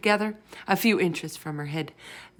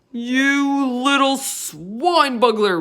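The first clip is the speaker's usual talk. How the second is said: slow, higher, louder insult; furious roar; 8 semitones higher, 10 dB louder, much slower than usual. A narrator's reading. Bloodbottler raging at the BFG, in a slow furious roar. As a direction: drag out a raging giant's insult. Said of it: "roared the blood bottler".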